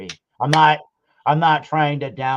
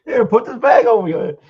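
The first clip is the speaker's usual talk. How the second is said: cartoon character voice; 12 semitones higher, 9 dB louder than usual. Joking with his cohost, in a high, loud goofy voice.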